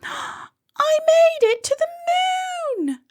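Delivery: very high, slow, triumphant cry; excited cry. A narrator's reading. Molly thinking she has reached the moon, crying out with joy. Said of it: "she cried".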